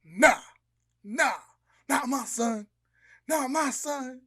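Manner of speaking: loud indignant dad; outraged religious dad. Acted. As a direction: forbid it like a scandalized father. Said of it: "the dad's like".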